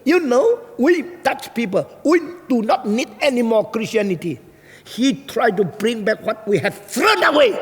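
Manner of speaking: high-pitched furious retort; very angry. Retold or imitated. Dutch woman answers why she's mad, high angry rant. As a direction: rant back in a high, heated voice. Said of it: "Kenapa lu marah sekali".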